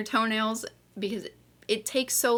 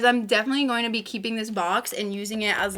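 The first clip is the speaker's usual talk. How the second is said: ordinary talk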